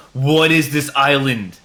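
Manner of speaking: loud, slow mock outrage; mad driver. Angry driver raging at a traffic island, mock mad. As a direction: bellow it in hammed-up disbelief. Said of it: "get real mad".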